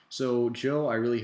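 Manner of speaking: loud call of a friend's name; calling out to Joe. Real, not acted. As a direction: hail your buddy Joe.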